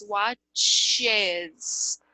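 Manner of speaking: WATCHES slowly split into two syllables, watch-ez; extra syllable. Shown as a demonstration. Teacher showing the extra syllable, WATCHES drawn out as watch-ez. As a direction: stretch out the added -ez in WATCHES. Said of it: "uma sílaba a mais".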